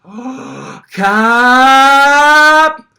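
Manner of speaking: very high, drawn-out excited Oh Kap; imitating a delighted Thai employee, long and high; long high-pitched Kap. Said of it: "he draws it out real long and real high-pitched".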